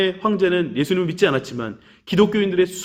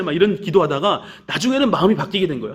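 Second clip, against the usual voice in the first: ordinary talk, nothing marked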